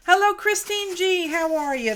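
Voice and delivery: high, loud bright hello; cheery greeting. Real, not acted. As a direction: sing out a welcome. Greeting a viewer by name.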